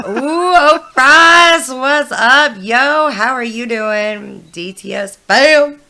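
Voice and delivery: loud, high, drawn-out hello; excited greeting. Real, not acted. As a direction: greet a friend with big energy. Greeting a viewer on stream.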